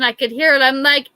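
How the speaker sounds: ordinary delivery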